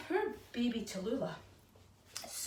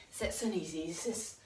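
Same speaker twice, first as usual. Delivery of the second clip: ordinary talk, nothing marked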